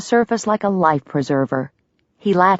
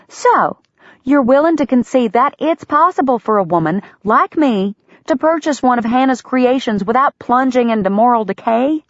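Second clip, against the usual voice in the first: higher, insistent leading question; pointed, pressing; 8 semitones higher, 3 dB louder than usual. A narrator's reading. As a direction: corner him with logic.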